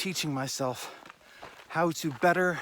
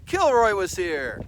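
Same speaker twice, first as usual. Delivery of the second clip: mock announcement; 4 semitones higher, 6 dB louder, slower than usual. Jokey aside reading a mark.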